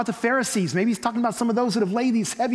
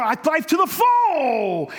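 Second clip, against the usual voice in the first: "full" shouted high, loud and long; mock pulpit roar stretching "full"; stress on "full"; 10 semitones higher, 5 dB louder, slower than usual. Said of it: "we stress the full".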